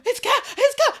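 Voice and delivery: gasp, then high, loud excited cry; freaking out. Retold or imitated. Spotting her friend walking to the stage, freaking out. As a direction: gasp and squeal it, laughing. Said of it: "I was freaking out".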